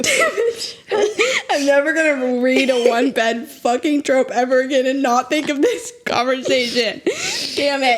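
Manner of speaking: laughing through the words; crying with laughter. Real, not acted. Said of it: "That was beautiful".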